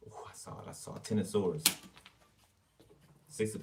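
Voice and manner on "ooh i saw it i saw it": whisper. Real, not acted. Reader reacting to Death card.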